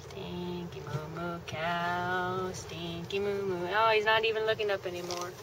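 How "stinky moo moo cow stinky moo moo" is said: slow, softly crooned nickname; drawn-out cow call. Real, not acted. Calling to cows in passing, slow drawn-out croon. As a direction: stretch every word out lazily to the cows.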